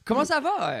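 high welcoming voice. Acted. Greeting guests in put-on voice.

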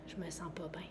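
breathy whispered confession; barely voiced, quiet admission. Retelling feeling unwell, quoted self.

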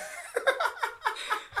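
high, helpless giggling fit; burst of laughter. Real, not acted. Host laughs at missing the name's pun, helpless laughter. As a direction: crack up in a long high laugh.

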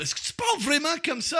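high mock-incredulous question; mimicking baffled questioners. Everyone asking the comedian about his voice, high mock questioning. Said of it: "tout le monde parle".

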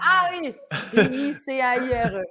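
loud, high mock realization, laughing; French listeners catching on. French listeners finally getting her surname, mock realization. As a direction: mimic delighted French recognition. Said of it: "les Français ont fait".

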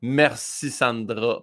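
slow, broad Saint-Hyacinthe Québécois Merci Sandra; Québécois accent. Retold or imitated. Thanking the program director in broad Québécois accent. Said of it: "un Sandra bien, prononcé québécois Saint-Hyacinthe".

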